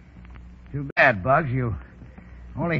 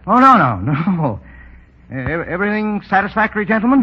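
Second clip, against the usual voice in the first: high, nervous brushing-off with a laugh; forced cheer; 7 semitones higher, 3 dB louder than usual. Acted. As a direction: hide the pain behind brightness. Wounded man hides his injury.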